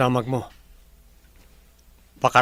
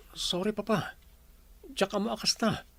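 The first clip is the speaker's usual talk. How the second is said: meek apology; 6 semitones higher, 8 dB quieter than usual. Acted. Son apologising to scolding father.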